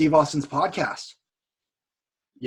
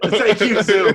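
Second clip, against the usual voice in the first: laughing through a loud, higher thank you; 4 semitones higher, 8 dB louder, much slower than usual. Real, not acted. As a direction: cheer it while cracking up. Cheering the sponsor Zoom.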